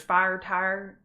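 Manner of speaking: slow hometown "fire tire", long I for OU; Southern pronunciation. Shown as a demonstration. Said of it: "fire tire means fire tower".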